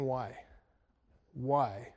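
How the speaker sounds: ordinary talk, nothing marked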